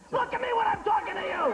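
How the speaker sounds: high, hammed-up scolding yell; mock scolding. Host scolds a shy contestant, mock-angry yell. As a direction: snap at them in pretend outrage.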